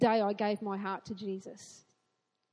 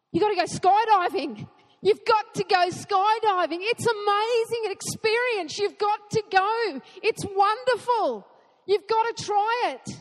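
higher and much louder, an enthusiastic shout; excited urging; 8 semitones higher, 7 dB louder than usual. Acted. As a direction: sell it like someone just back from a thrilling jump. Urging the congregation to try skydiving.

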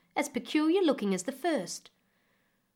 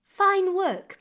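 a much higher, louder exclamation of praise; impressed queen; 9 semitones higher, 6 dB louder than usual. Acted. Queen admiring the cloth, high and loud.

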